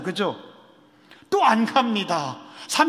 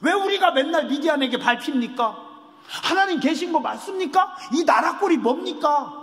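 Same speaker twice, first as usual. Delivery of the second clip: higher, heated complaint with a shout; indignant protest; 5 semitones higher than usual. Acted. Gideon arguing with God, indignant raised voice. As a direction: demand answers, voice rising in frustration. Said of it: "기드온이 하나님께 따집니다".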